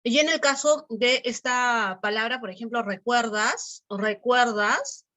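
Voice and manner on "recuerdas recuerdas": "recuerdas" drawn out, rising at the end; questioning melody. Shown as a demonstration. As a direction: ask "recuerdas?" slowly, lifting the last syllable. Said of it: "tiene una melodía distinta a decir recuerdas". («recuerdas» con entonación de pregunta, alargado)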